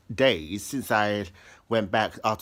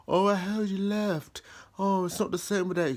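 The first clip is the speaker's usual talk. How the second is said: gushing teacher; 9 semitones higher than usual. Retold or imitated. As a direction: play a fond teacher missing him. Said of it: "some of the teachers going".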